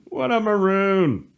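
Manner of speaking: ordinary talk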